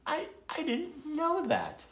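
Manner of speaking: high, slow, halting disbelief; voiced character caught by surprise; taken aback. A narrator's reading. Surprised turtle's reply in a story.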